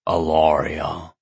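low, slow and lovestruck; dreamy sigh. A narrator's reading. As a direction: say her name like a daydream. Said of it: "daydreamy grin".

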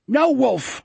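high-pitched, urgent shout. A narrator's reading. Jack trying to stop Wolf fighting, shouting. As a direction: cry out to stop a friend. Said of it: "Jack shouted".

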